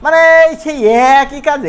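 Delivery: ordinary talk, nothing marked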